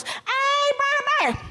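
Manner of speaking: very high rabbit holler; high-pitched "hey you" holler. A narrator's reading. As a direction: call from far up a tree, high and drawn. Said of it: "he hollers".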